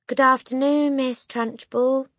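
high, slow sing-out from a class; chirruping children. A narrator's reading. Class answering the headmistress, chirruped high. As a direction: chirrup it loudly in chorus style. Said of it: "they chirruped".

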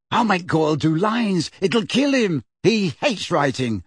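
high, gleeful scheming; delighted schoolboy glee; happy. A narrator's reading. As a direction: relish the plan. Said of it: "said Ron happily".

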